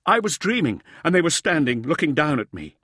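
high-pitched, loud frightened outburst; startled cry. Acted. Uplander explains his fever dream to Fifth, high cry settling slightly. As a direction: cry out in fright, easing down towards the end. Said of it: "he cried".

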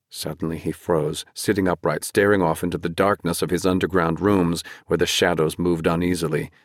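plain reading voice

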